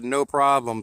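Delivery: ordinary delivery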